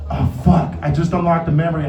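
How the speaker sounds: ordinary talk, nothing marked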